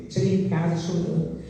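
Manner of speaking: normal talking voice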